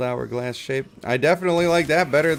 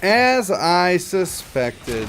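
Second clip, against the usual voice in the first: slow smug drawl; 6 semitones higher, 4 dB louder, much slower than usual. Acted. Drawn-out smug remark.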